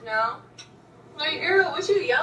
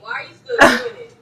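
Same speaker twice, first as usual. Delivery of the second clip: loud; 8 semitones higher, 9 dB louder than usual. Real, not acted. Annoyed repeat.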